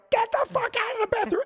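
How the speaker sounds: high, fast desperate bark; frantic yell. Retold or imitated. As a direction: yell it in sheer panic. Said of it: "and I go".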